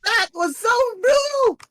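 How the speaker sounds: high, cracking cry of shock; nearly crying out in disbelief; overwhelmed shriek. Real, not acted. Overwhelmed by clutch finish.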